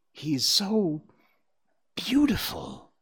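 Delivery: put-on high, dreamy child's voice; a young fawn lost in admiration; awed thought. A narrator's reading. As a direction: marvel to yourself, high and lingering. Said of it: "he thought".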